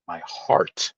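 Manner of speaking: My heart whispered, breathy and soft. Acted. Streamer savoring his own heart, whispered. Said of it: "I like the way I pronounce that".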